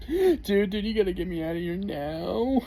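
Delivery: high, frantic plea from a terrified buddy; panicked friend. Retold or imitated. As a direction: beg your friend to get you out, pitched high. Friend begging to be picked up.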